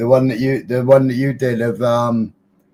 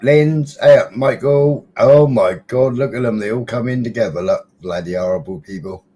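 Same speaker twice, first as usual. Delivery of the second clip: normal talking voice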